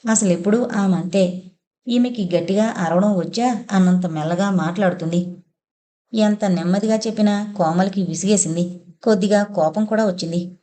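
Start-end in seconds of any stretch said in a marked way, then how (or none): none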